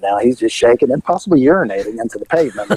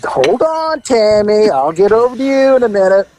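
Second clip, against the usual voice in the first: pitched up, coaxing; calming her down; 7 semitones higher than usual. Retold or imitated. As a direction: call over to hold her off. Calming an angry neighbor.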